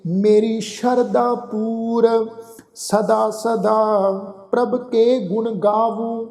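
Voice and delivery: slow, drawn-out chanted reading of the verse; measured Gurbani path recitation; slow scripture recitation. Shown as a demonstration. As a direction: recite each line slowly and reverently. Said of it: "ਠੀਕ ਹੈ ਨਾ ਜੀ".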